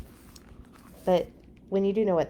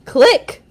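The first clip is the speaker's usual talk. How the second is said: camera click; 8 semitones higher, 10 dB louder than usual. Acted. Camera sound.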